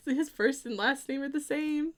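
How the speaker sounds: high, laughing retelling; laughing excitement. Retold or imitated. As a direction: laugh through the quote. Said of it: "was like".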